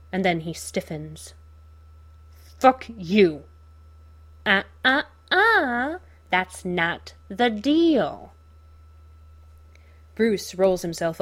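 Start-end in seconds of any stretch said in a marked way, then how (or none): 2.6-3.4 angry. Acted. Captive Bruce snaps at Joker. 4.4-8.3 high, slow taunting villain; sing-song mocking clown, drawn out; Joker voice. Joker taunts captured Batman.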